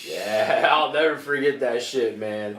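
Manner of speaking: loud laughing memory; loud amused laughter. Real, not acted. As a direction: laugh through the line. Laughing recollection.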